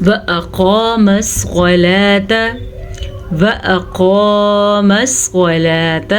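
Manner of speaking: slow, drawn-out Quran recitation; tajweed recitation. Shown as a demonstration. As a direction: chant it slowly, stretching the vowels. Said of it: "قاف کو پر پڑھیں گے". (تجوید کے ساتھ آہستہ تلاوت)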